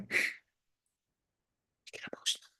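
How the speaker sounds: whispered "get emotional", barely audible. Real, not acted.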